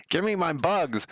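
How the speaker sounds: high, whiny mock plea; mock pleading. Streamer begging his build for error messages, high whiny plea. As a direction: beg the compiler like a child asking for candy.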